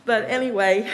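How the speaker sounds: said through a nervous laugh. Real, not acted.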